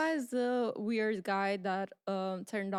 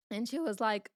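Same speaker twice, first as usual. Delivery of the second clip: ordinary talk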